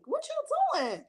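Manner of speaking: high, slow teasing squeal; playfully scandalized; mock surprise. Host teasing a viewer about dropping a link, mock surprised.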